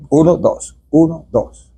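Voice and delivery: slow counted beats; counting the pause. Shown as a demonstration. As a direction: count out the two-second pause. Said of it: "Esa es la pausa de dos segundos". (contando la pausa despacio)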